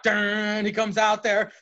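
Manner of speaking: ordinary talk, nothing marked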